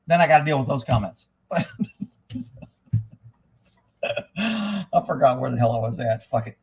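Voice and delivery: usual speaking voice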